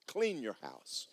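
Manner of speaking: slow, soft blunt comeback; blunt retort. Retold or imitated. Maintenance man telling the homeowner, softly and bluntly. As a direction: say it dry and unimpressed. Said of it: "And I said".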